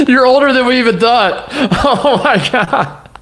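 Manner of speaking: words squeezed out between high laughs; can barely talk for laughing; helpless laughter. Real, not acted. Cracking up at the old YouTube video, laughing hard.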